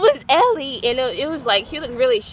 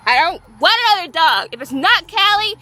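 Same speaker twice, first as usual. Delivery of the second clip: stubborn refusal; 5 semitones higher, 7 dB louder than usual. Retold or imitated. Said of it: "and I was like".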